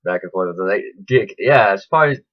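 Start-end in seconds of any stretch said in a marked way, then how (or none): none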